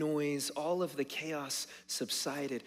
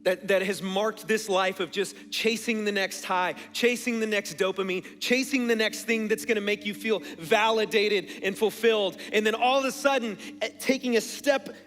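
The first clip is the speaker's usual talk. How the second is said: loud, higher-pitched piling up of a list; forceful preaching; 5 semitones higher, 8 dB louder than usual. Real, not acted. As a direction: preach the noise and chaos with raised voice. Preaches with raised voice.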